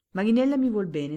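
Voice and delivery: alto e molto forte, con foga; esclamazione. A narrator's reading. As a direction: proclamalo con forza orgogliosa. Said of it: "esclamò il barone". (esclamazione appassionata del barone)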